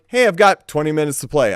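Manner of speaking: usual speaking voice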